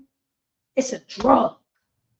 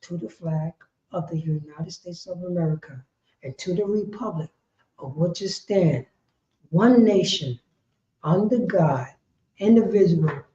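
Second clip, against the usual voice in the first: mock pledge recital; 7 semitones lower, 6 dB quieter than usual.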